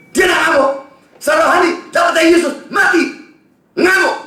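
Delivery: preaching at full force. Acted. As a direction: hammer it home to the congregation. Preacher contrasts doctor and gospel.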